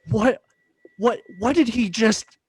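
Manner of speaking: high, loud, stunned disbelief; shocked disbelief. Retold or imitated. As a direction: sputter in shocked confusion. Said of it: "I was like".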